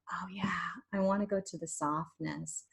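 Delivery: soft longing whisper. Pretend. Voicing the listener's inner wish for softness, whispered. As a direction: breathe it like a secret wish.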